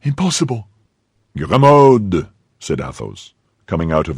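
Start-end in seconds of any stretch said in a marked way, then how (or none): 0.0-0.7 high, incredulous exclamation; astonished cry. A narrator's reading. Servant cannot believe who it is, astonished cry. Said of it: "cried Grimaud". 1.4-2.3 raised, drawn-out call of the name; stern call. Acted. Master calls his noisy servant, stern call.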